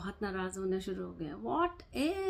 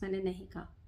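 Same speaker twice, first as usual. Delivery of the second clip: ordinary talk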